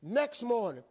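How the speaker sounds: ordinary delivery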